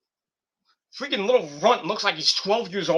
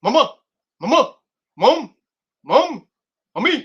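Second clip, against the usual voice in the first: higher, louder, rising whiny calls for mom; Stewie nagging; 7 semitones higher, 6 dB louder than usual. Retold or imitated. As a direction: pester mom over and over, each call climbing. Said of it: "Stewie going".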